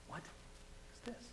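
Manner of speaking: slow, barely audible whisper; bewildered whisper. Retold or imitated. Alone in the hospital room after hearing a voice, bewildered whisper. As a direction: whisper it slowly to yourself, puzzled.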